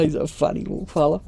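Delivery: ordinary delivery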